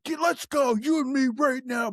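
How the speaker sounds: pitched high, calling him out to fight; mock challenge shout. Father-in-law in the driveway daring him to fight, mock challenging shout. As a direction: imitate a man itching for a fight.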